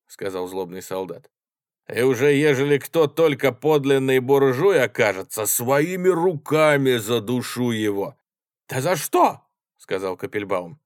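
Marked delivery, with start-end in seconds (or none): 1.9-8.2 loud, high, slowing at the end; menacing threat. A narrator's reading. Soldier threatens any bourgeois he finds, angrily. 8.7-9.4 high, loud question; indignant protest. A narrator's reading. Kapelbaum objects to the soldier's threat, indignant.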